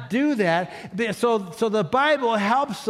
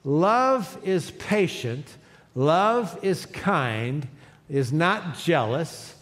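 slow, deliberate reading of scripture aloud; measured group recitation; 4 semitones lower, much slower than usual. Shown as a demonstration. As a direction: read it out slowly with the congregation. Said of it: "let's read this out loud".

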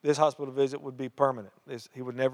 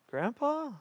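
high, soft, amazed question; disbelieving rise; surprised; 12 semitones higher, 4 dB quieter than usual. Retold or imitated.

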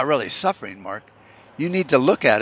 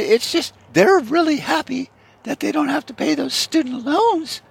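much higher, falsetto parody of a chirpy co-anchor; high mock newswoman. Co-anchor Margaret answering Bob on air, chirpy falsetto mock newswoman. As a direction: squeak it out like a cheerful TV anchorwoman.

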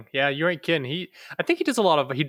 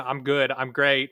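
usual speaking voice

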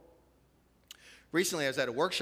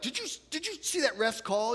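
much higher, eager rapid-fire question; excited parent; 12 semitones higher, 4 dB louder than usual. Retold or imitated. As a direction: gush like a parent reliving the game. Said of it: "And you're all excited".

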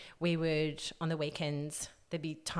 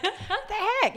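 much higher, louder disbelief; indignant; 7 dB louder, slower than usual. Retold or imitated. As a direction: react in shocked disbelief. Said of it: "you're like".

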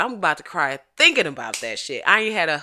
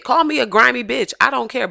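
normal talking voice

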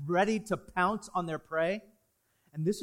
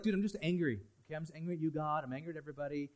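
dismissive Cain; 3 semitones lower, 7 dB quieter, faster than usual. Retold or imitated. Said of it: "Cain was like".

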